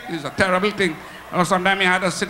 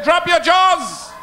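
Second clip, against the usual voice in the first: hostess shouting; 10 semitones higher, 4 dB louder, slower than usual. Acted. Hostess repeats louder.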